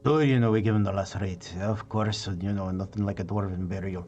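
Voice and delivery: quick, rambling Scottish dwarf; Scottish dwarf voice, trailing downward; Scottish accent. Acted. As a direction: explain it offhand in a Scottish brogue. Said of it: "the Scottish in me".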